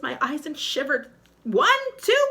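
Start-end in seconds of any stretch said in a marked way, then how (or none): 1.4-2.3 very high, loud count; shrill witch counting, final. Acted. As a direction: count again with menace.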